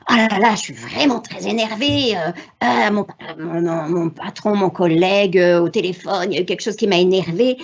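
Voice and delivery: lightly played annoyed parent who then calms down; a touch of parental irritation settling into calm; mock-exasperated parent. Shown as a demonstration. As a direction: show the child your frustration, then choose to breathe. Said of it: "on va dire".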